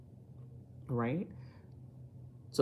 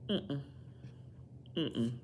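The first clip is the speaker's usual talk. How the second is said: ordinary talk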